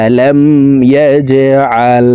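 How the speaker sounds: slow tajweed chant in repeated phrases; sustained, melodic teaching recitation; Quran recitation drill. Shown as a demonstration. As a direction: recite with a very long final vowel.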